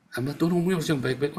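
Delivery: fast, offhand restating; quick repeat. Acted. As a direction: rattle the rule off without proclaiming it. Repeats critic's rule more softly.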